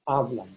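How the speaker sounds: HA of "Hablan" strong, end falling; stress on first A. Shown as a demonstration. Teacher returns to the boot, HA stressed in "Hablan". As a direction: hit HA in "Hablan". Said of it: "back to the a".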